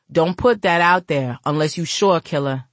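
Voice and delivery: ordinary delivery, nothing marked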